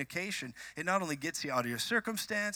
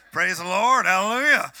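booming, raised revival-preacher cry; loud praise shout; 3 semitones higher, 12 dB louder than usual. Pretend. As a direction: belt it out like a revivalist. Bursts into a church shout.

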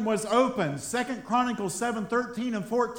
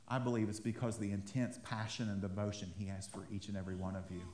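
much lower and much softer, heartfelt; tender and sincere; 12 semitones lower, 12 dB quieter, faster than usual. Real, not acted. Pastor tells the congregation of Jesus' devotion, tender and hushed. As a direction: drop low and soft as if confiding.

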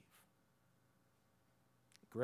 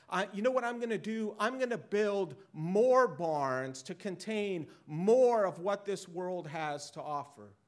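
boastful rich fool; 8 semitones higher, 11 dB louder than usual. Retold or imitated. Rich fool voiced in sermon.

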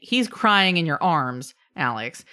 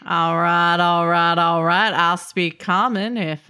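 louder, laid-back Texan McConaughey drawl; Matthew McConaughey; 4 dB louder than usual. Acted. Gnome answers the party in a Texan McConaughey voice. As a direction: lean into the lazy Texan McConaughey charm. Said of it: "switches to Matthew McConaughey accent".

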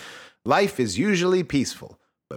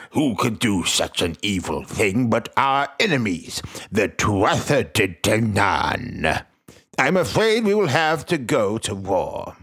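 lightly voiced king; 4 semitones lower, slower than usual. King addresses the great hall.

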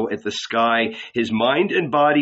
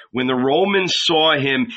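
ordinary delivery, nothing marked

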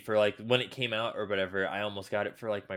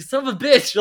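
high, loud cry of outrage; outraged yell; 13 semitones higher, 8 dB louder than usual. Retold or imitated. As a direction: yell it like you got ripped off. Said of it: "I was like".

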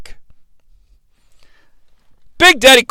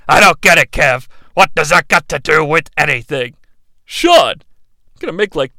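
usual speaking voice

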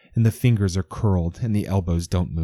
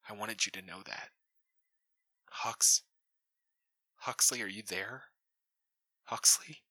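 much softer, hushed and worried; soft deputy; 12 dB quieter than usual. A narrator's reading. Deputy calling Huxley on the comm, hushed and worried. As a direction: call him gently, then anxiously. Deputy speaks after the letter.

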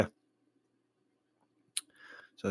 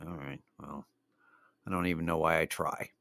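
deflated mutter; 4 semitones lower, 7 dB quieter than usual. Retold or imitated. Deflated aside after no reaction.

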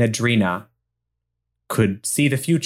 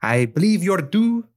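put-on higher, tentative Spanish accent; pseudo-Spanish accent; 5 semitones higher than usual. Officer announcing an inspection, tentative Spanish accent. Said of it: "sound of a young Spanish woman".

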